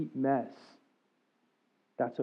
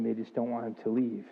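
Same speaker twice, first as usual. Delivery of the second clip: usual speaking voice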